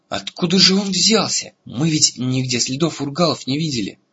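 where on earth did that come from; startled disbelief. A narrator's reading. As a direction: voice jumps up in bewildered dismay. Eragon's startled thought at sudden attacker.

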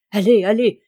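loud urging call from horseback; shouted. A narrator's reading. Man-at-arms calls loudly to young Aubry at the quintain, shouting. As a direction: call out to spur the rider on. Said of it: "criait Jeannin".

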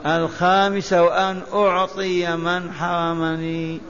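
ordinary delivery

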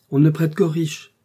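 maxime lancée plus haut et plus fort; asséner le proverbe, sentencieuse; ton sentencieux. A narrator's reading. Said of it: "répliqua sentencieusement la comtesse". (la comtesse réplique d'un ton sentencieux)

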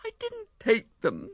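high, slow woman's voice, pleading; wife protesting. A narrator's reading. Wife denying taking flowers, high pleading protest. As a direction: protest your innocence. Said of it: "said Ethel".

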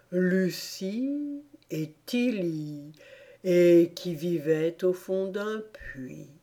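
slow, drowsy Dormouse telling a tale; sleepy storyteller. A narrator's reading. Dormouse telling the tea party a story, slow and drowsy. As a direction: drag it out like a half-asleep storyteller.

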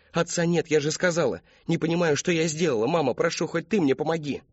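put-on high, urgent young voice; desperate pleading. A narrator's reading. Daughter begging her mother, high desperate plea. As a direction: beg mother for support.

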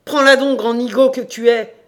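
very loud, high exasperated insult; angry shout. Acted. Damned landlord giving the farmer the receipt, exasperated shout. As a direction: bellow at the fool to take it.

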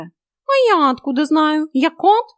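high, loud, drawn-out cat protest; exasperated cat. A narrator's reading. Cat disclaims any knowledge to heroine, exasperated. Cat rejects her question.

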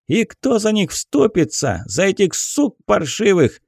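scornful shouting. Acted. Peasant jeers at the captain.

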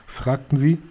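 plain reading voice

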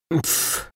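короткое беззвучное фырканье «пф»; фыркнуть с пренебрежением; презрительное фырканье. A narrator's reading. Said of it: "фыркнул Вихрь". (презрительно фыркнуть)

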